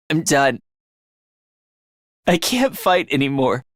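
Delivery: low, spent surrender; defeated. Acted. Fighter telling team he can't fight anymore, defeated. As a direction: sink low, all fight gone.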